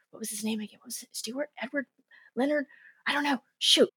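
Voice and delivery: confer under your breath so he can't hear; hushed conferring. Retold or imitated. Players huddling so the guy won't hear, hushed.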